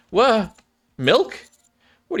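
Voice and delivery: high, startled exclamation; high, surprised cry. Acted. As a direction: react as if someone jumps out. Surprised to see Milk.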